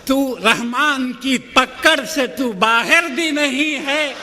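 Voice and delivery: measured, rhythmic verse delivery; poetry recitation. Acted. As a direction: declaim each line with steady cadence. Poet recites verses at a mushaira.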